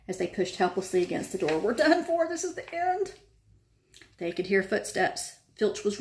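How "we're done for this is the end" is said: high, strained panic; panicked despair. A narrator's reading. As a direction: despair at the locked door. Trapped, despair.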